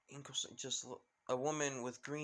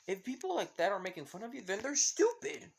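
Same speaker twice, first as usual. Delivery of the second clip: high, loud outburst of disbelief; indignant disbelief; 10 semitones higher, 5 dB louder than usual. Acted. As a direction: burst out high in outraged defense of a friend. Indignant reply defending a friend.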